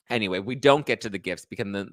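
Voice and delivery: ordinary delivery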